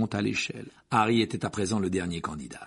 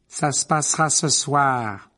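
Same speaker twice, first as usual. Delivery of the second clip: louder, slow, ringing trance voice; hard, resounding voice; 5 semitones higher, 7 dB louder, slower than usual. A narrator's reading. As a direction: pronounce it like a possessed oracle. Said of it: "une voix dure et sonore".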